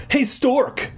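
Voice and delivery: high, teasing schoolboy call; high hail across a hallway; boy calling out. A narrator's reading. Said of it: "a seventh grade boy called to me".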